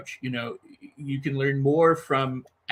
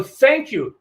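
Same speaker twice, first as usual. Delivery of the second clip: bright, much higher and louder thanks; overjoyed thank you as if already received; joyful thank you; 9 semitones higher, 7 dB louder than usual. Shown as a demonstration. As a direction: burst out with grateful joy. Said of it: "assume the healing ... and go".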